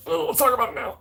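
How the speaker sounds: high, snippy reply to a partner; mock retort. Answering a partner's "we'll talk later", mock snippy and high. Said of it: "It's like".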